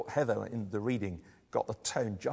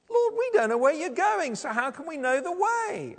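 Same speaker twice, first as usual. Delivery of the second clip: high, loud, clueless question; rather stupid; 8 dB louder than usual. Acted. Thomas questioning the Lord, rather stupidly baffled. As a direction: blurt it out like someone who has missed the point. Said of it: "who rather stupidly says".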